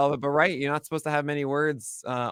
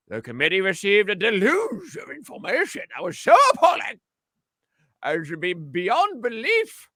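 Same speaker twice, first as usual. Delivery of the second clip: put-on old-timey voice; 7 semitones higher than usual. Shown as a demonstration. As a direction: read it like an 1890s orator. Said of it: "I'm gonna put on my best old-timey voice for you".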